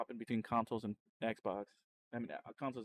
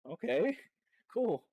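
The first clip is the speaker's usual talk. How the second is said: high, slow, laughing okay cool; a stunned laugh, higher and louder; 12 semitones higher, 9 dB louder, slower than usual. Retold or imitated. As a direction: laugh through it in happy disbelief. Retold reaction with a laugh.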